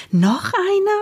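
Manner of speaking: high, surprised question. A narrator's reading. Mama questions Omsel about another surprise, astonished. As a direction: shoot the pitch far up in disbelief. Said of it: "Mama sah Omsel fragend an".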